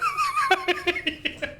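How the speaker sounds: very high shrieking cackle; high evil cackle; maniacal cackle. Acted. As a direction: shriek with mad laughter. Mad laugh.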